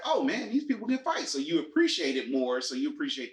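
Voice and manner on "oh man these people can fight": amazed. Retold or imitated. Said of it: "you realize, like".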